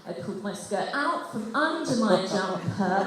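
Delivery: ordinary talk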